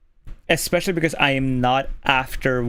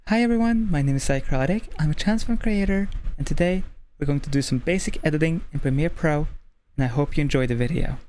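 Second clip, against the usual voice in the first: lightly bright video intro read; intro read again. Shown as a demonstration. Redoing the intro for comparison, upbeat host read. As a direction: open the channel like a host. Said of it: "if I go and do the exact same thing".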